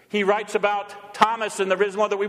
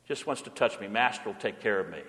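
much softer, lower repeat of the line; softly echoing the line, lower; 10 semitones lower, 7 dB quieter, faster than usual. Acted. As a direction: let it fall away, tender and hushed. Repeated line about the cat.

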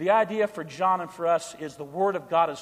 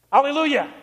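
joyful hallelujah; 7 semitones higher, 4 dB louder than usual. Real, not acted. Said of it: "Say it with me".

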